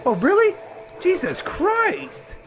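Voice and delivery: very high, startled cry; shocked exclamation. Real, not acted. Player shocked by the game, stunned outburst. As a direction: cry out in disbelief, drawing out the last words.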